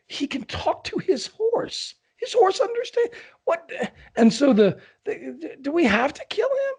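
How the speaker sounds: high-pitched mock disbelief; astonished onlookers. Indians amazed at talking to horse.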